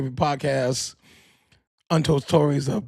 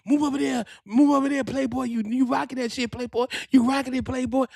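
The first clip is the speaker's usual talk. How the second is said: much higher, hyped encouraging voice; 10 semitones higher than usual. Retold or imitated. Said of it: "Puffy said".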